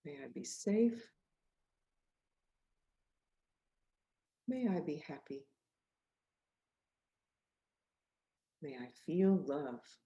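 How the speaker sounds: calm loving affirmation; gentle self-wishes. Shown as a demonstration. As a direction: lead children gently. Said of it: "Can you say it with me".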